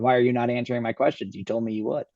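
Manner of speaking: normal talking voice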